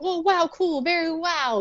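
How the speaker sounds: high, airy fake awe; hammed-up starstruck crowd; mock gushing. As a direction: gush with empty, over-the-top praise. Crowd gushing at Turbo Man.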